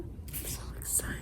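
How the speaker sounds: soft, breathy whisper of excitement; excited whisper. Real, not acted. Mother whispering to her unborn baby, excited.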